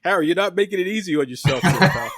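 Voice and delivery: teasing through laughter; laughing. Real, not acted. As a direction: laugh while you talk. Laughing while teasing Harry.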